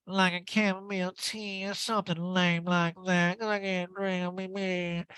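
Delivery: high drawn-out put-on voice; Erica impression. Erica turning down a drink, high drawling voice. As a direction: mimic her, high and dragging. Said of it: "She goes".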